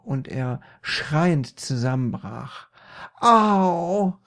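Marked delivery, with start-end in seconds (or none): 3.2-4.2 loud, high yelp of pain; pained cry. A narrator's reading. Peter hit in the eye, loud high cry. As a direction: cry out, acted hurt. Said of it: "rief er".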